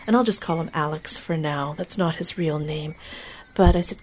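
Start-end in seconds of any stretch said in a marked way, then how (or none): none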